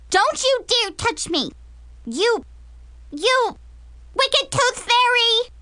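put-on high-pitched indignant child; screaming little girl. Little girl accuses the wicked tooth fairy, screaming. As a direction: scream it as a small child, haltingly on "you". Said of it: "Brianna screamed at the top of her lungs".

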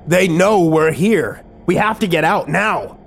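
high, drawn-out urgent cry; urgent alarm. Acted. Narrator warns a companion they must get out, urgent alarm. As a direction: press the warning, pitch well up. Alarm at being found in hideout.

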